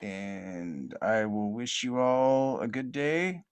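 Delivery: show sign-off. Real, not acted. Podcast host closing the episode for listeners, showy announcer sign-off. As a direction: stretch the words and build to a big finish.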